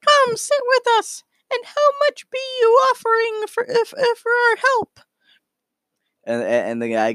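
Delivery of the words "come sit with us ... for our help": very high squeaky critter voice; Space Squirrel. Acted. Squirrel negotiating pay with the townsman, very high squeaky voice. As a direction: squeak out the bargain like a small cartoon animal. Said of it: "and Space Squirrel says".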